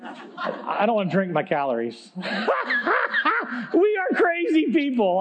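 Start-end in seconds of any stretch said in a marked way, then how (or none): 2.2-3.7 laughing at himself. Real, not acted. Preacher cracking up at his own diet joke, laughing. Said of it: "I'm just laughing at me here". 3.7-5.2 high, slowed words through real laughter; laughing at himself. Real, not acted. Preacher laughing at himself and the congregation, cracking up. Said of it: "I'm just laughing at me here".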